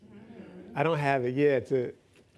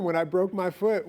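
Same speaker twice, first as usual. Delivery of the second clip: normal talking voice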